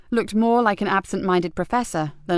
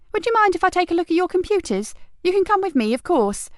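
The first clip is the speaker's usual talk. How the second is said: put-on higher, brisk young officer; detective asking the husband for access; 11 semitones higher than usual. A narrator's reading. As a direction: ask politely but keenly. Kat asks to see computers.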